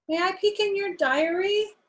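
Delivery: high, sweet polite asking voice; politely. Acted. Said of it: "asked George politely".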